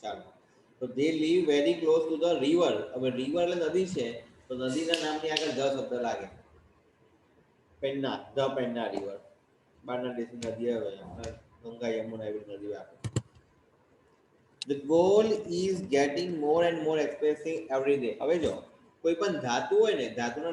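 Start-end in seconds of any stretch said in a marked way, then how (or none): 0.9-2.7 slow dictation. Shown as a demonstration. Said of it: "teacher reads corrected sentence". 14.7-18.2 very slow, word by word English dictation. Shown as a demonstration. Teacher dictating the sentence slowly to the class. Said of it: "teacher dictating English sentence".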